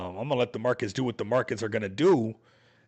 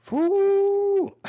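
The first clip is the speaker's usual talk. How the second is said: high whooping cheer; loud, high "woo" with a laugh; excited woo; 5 semitones higher, 5 dB louder than usual. Real, not acted. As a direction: let out a big party whoop, then laugh. Celebratory cheer.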